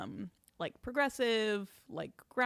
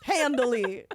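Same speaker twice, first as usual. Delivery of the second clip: louder echo of "Handily"; mocking echo; 3 semitones higher, 7 dB louder than usual. Guest echoing friend's boast about the waitlist, mocking echo. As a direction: throw "Handily" back loudly, teasing.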